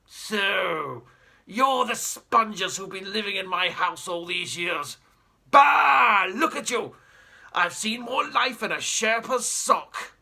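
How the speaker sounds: put-on haughty old explorer, barking at the family; pompous great uncle. Pompous great uncle sneering at the spongers in his house, loud and scornful. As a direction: look down your nose and bellow.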